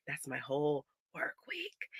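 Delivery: slow, soft whispered despair. Real, not acted. Mom telling viewers the lost week, whispered in defeat.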